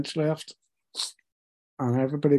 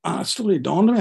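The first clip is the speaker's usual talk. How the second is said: ordinary talk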